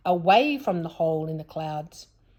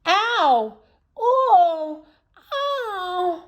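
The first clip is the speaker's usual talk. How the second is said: high loud cries of pain; yelling in pain; 11 semitones higher, 8 dB louder, much slower than usual. A narrator's reading. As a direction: yelp out loud, hurting. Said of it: "he began to yell".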